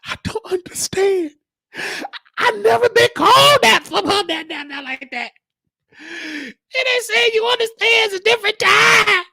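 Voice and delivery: high wailing retelling of being hurt; mock crying. Caregiver retelling being called a slur, mock crying. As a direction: whine it out as if sobbing. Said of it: "I cried".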